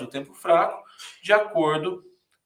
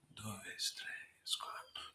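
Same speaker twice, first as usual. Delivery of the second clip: whispered beat count; 5 semitones lower, 19 dB quieter, much slower than usual. Shown as a demonstration. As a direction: whisper the count under the song. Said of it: "tenta contar de um até dois".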